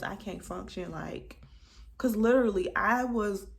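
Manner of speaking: ordinary talk, nothing marked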